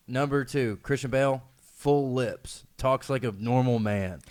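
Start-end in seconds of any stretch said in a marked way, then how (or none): none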